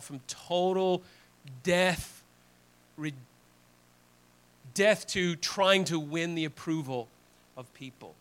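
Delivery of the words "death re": ordinary talk, nothing marked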